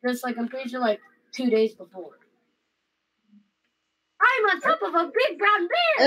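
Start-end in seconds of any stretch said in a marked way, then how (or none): 4.2-6.1 excited exclaiming. Acted. Character announces in roleplay.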